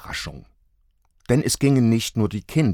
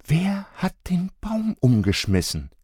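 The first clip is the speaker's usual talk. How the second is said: higher mother voice; mother asking; 10 semitones higher than usual. A narrator's reading. Mother questioning the children, raised pitch. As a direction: ask like a puzzled mum. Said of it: "wiederholte Julia".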